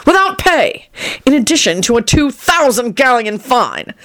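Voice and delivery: high-pitched punitive outburst; angry sentencing. A narrator's reading. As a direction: pile on the punishment in rising anger. Boss thundering the punishment.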